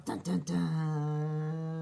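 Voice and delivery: slow, low dun dun dun; suspense sting. Acted. Reader adds dramatic music to the villain's arrival. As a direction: sing a creepy suspense sting.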